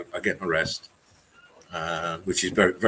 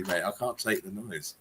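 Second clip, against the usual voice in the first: usual speaking voice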